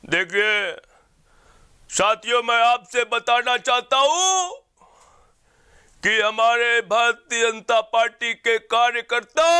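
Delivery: slow booming rally oration; Amit Shah voice. Acted. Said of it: "Amit Shah जी की आवाज़".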